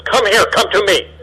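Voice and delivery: mild scolding parent summons; stern parent calling. Retold or imitated. Parent scolding a naughty child, calling them over. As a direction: call the naughty child over, a bit sharp. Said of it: "the father or the mother looks at the child and says".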